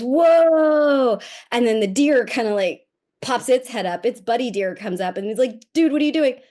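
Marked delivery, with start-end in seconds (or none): 0.0-1.2 loud, high drawn-out whoa; mock amazed kid; son's whoa. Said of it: "he goes". 5.7-6.4 higher, louder buddy deer calling out; mock deer buddy. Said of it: "That's my voice to it".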